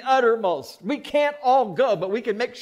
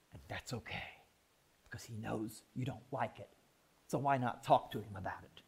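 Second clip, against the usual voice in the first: ordinary delivery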